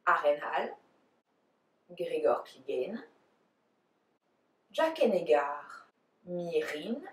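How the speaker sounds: names pronounced in a French accent. Shown as a demonstration. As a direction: say each name the French way. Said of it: "try to do it the French way".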